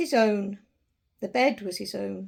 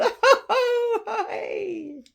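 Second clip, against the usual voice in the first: much higher, loud hearty ho ho laugh; splendid laugh; 11 semitones higher, 6 dB louder, much slower than usual. Acted. Scrooge bursting into his first laugh in years, hearty laughter. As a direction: burst into a big rusty laugh. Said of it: "it was a splendid laugh".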